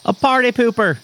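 high fake Irish punchline; mock Irish accent. Leprechaun delivering a joke's punchline, in mock Irish accent. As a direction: land it in a put-on Irish brogue.

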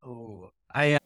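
normal talking voice

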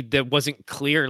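usual speaking voice

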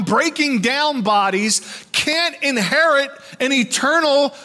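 high, loud, impassioned pulpit delivery; fiery sermon voice; full-force preaching. Acted. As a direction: preach it out to the whole church.

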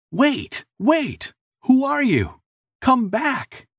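high-pitched calling out after someone; urgent high call; shouting. A narrator's reading. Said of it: "the man shouted".

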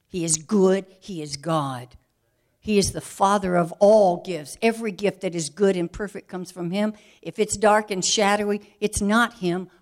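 loud, emphatic declaration; preached loud; preaching at full force. Acted. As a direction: proclaim God's goodness.

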